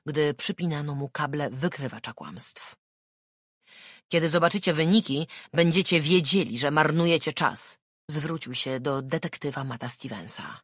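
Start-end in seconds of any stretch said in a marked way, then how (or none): 4.1-7.8 defiant. Acted. Suspect defiant at polygraph.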